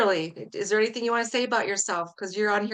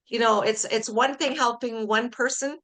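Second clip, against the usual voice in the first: normal talking voice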